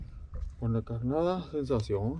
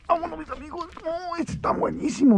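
sudden high-pitched yelp of joy as the fish bites; excited cry; 13 semitones higher than usual. Real, not acted. Fisherman greets a big catch with a high excited cry. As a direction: yelp with delight at the strike.

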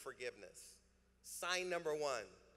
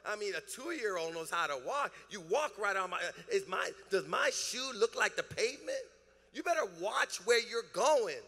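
mock tirade at a clumsy churchgoer, growing louder; angry outburst; 2 semitones higher, 4 dB louder than usual. Pastor pretends to yell at Lisa for stepping on his shoes, growing louder. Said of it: "blow up and say something like this".

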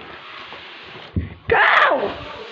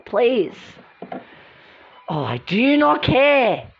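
normal talking voice

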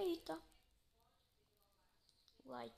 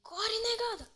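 higher urgent shout to run; being chased; 10 semitones higher, 4 dB louder, slower than usual. Real, not acted. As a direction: cry out to flee.

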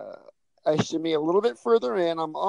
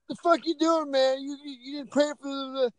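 mock angry bouncer; 9 semitones higher than usual. Bouncer confronting the guy sneaking in, mock angry. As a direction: snap at him like a bouncer catching a gatecrasher. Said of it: "he gave me a little spiel".

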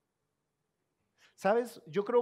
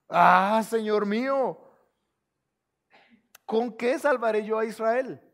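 protesting Gideon; 4 semitones higher, 11 dB louder than usual. Acted.

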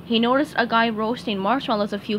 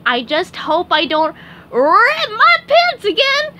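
high, slow, silly cartoon sponge voice; SpongeBob voice; 10 semitones higher, 8 dB louder than usual. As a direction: play the goofy sponge, hamming it up.

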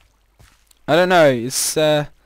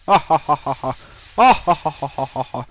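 hammed-up villain cackle fading out; evil laugh. After threatening the lava pit, mock evil cackle. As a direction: cackle like a cartoon baddie.

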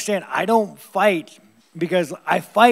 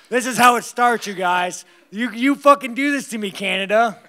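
louder, higher mock rant; mock outrage; 5 semitones higher, 7 dB louder than usual. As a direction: play up the outrage.

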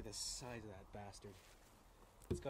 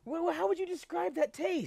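excited; 7 dB louder than usual. Real, not acted. After tasting berry.

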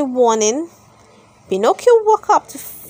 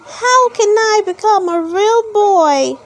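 higher, hopeful little puppet voice; Pinocchio asking; 8 semitones higher than usual. A narrator's reading. Pinocchio asking the Blue Fairy, higher hopeful voice. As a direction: ask it like a small wooden boy. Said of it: "Pinocchio asked".

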